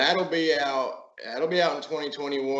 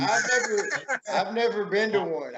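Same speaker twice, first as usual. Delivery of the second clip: laughing through the words, pitched up; 7 semitones higher than usual. Real, not acted. Laughing at being left out of the tour. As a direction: chuckle out the denial. Laughing denial he was there.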